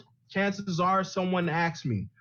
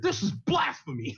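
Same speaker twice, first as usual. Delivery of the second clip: high, theatrical sports-pundit outrage; Stephen A. Smith impression; 12 semitones higher than usual. Mocking the commenter as a TV pundit in outrage. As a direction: ham it up like a TV pundit ranting at a critic. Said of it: "He sounded like Stephen A. Smith".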